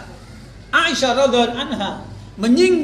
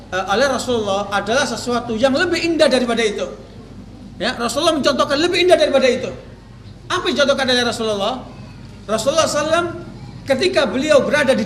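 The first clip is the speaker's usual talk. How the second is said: normal talking voice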